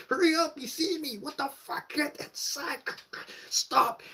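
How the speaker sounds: very high, frantic urging; squeaky, urgent mock panic; retold panic. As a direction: re-enact a squealing scramble to hide. Fighting to get inside the door.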